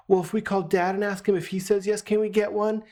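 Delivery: high imitated child's request; wheedling kids. Kids bargaining with mom to call dad, high wheedling.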